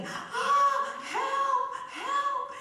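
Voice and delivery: very high, loud cry for help; screaming for help. Acted. Locked kids in the basement call for help, screaming. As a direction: shriek like a child locked in. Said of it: "they were screaming and yelling".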